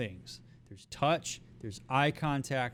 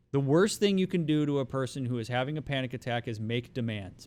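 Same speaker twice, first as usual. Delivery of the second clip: ordinary talk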